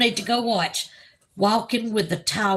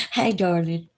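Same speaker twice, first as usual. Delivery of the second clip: ordinary delivery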